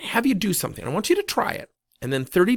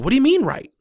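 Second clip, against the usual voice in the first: high, loud retold challenge; indignant disbelief; 13 semitones higher, 7 dB louder, faster than usual. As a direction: imitate a taken-aback comeback, pitched way up. Manager challenges the coach's answer.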